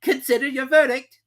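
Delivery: very high, loud royal command; a pompous king giving orders; King ordering the herald to read; King's voice. A narrator's reading. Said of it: "the king said".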